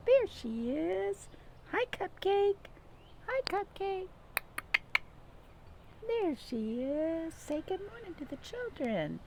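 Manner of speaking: high, sing-song voice for a pet; high cooing pet talk. Real, not acted. High baby talk to the dog.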